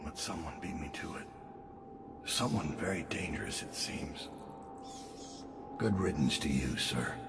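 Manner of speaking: barely above a breath, still and cold; very hushed. Acted. Assassin bidding the dead Regent farewell, very hushed. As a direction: let the words sink almost to nothing. Same lines, softer take, music under.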